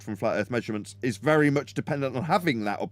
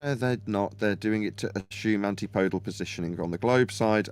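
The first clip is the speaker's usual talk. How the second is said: ordinary talk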